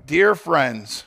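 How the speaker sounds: usual speaking voice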